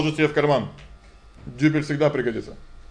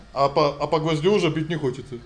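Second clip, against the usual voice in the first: ordinary delivery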